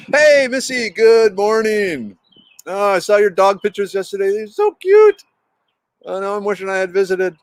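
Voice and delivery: greeting Missy in chat, high and gushing. Real, not acted. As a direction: squeal over the cute dog pictures. Greeting a chat friend warmly.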